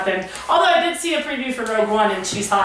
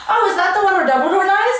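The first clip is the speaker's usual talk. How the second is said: higher, breezy imitation of a question; mock-innocent question, pitched up; clueless spoiler; 6 semitones higher than usual. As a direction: imitate someone blurting out a spoiler. Retelling the casual spoiler.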